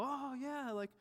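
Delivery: imitated offhand small talk; subtle brag. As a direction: pretend idle curiosity to set up a boast. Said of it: "we're not quite that subtle".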